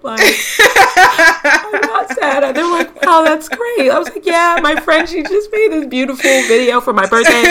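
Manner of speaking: high, laughing through tears; laughing reassurance. Retold or imitated. Telling strangers at the office she's fine, laughing. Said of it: "I'm like".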